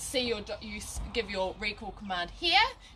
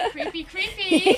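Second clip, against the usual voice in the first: laughing; 10 semitones higher, 10 dB louder, slower than usual. Real, not acted. Joking about glowing behind light.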